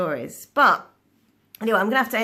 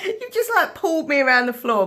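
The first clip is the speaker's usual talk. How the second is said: high, laughing disbelief; amused, high-pitched incredulity; incredulous laughing; 6 semitones higher, 3 dB louder than usual. Real, not acted. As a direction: laugh through the words, pitched right up. Laughing disbelief at drink offer.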